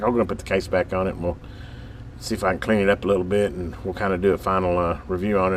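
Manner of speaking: usual speaking voice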